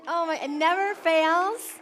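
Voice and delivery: ordinary talk, nothing marked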